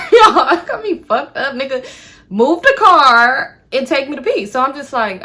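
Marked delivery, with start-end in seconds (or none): 0.0-1.8 high, loud laugh through the words; laughing disbelief. Real, not acted. Laughs at his absurd suggestion. 2.3-4.5 loud, high-pitched demand; indignant demand. Retold or imitated. Indignant reaction to his suggestion.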